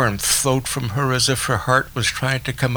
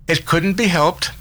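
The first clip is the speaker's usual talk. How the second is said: bright, higher, forced cheer; tense gaiety; 7 semitones higher than usual. A narrator's reading. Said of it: "cried Daisy with tense gaiety".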